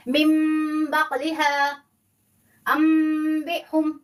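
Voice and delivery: very slow recitation, मिम held long; recited ikhfa demo. Shown as a demonstration. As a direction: draw out मिम बकलिहा अम्बिहुम like recitation. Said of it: "आपका इख्फा हो जाएगा". (धीमी तिलावत, मिम खींचकर)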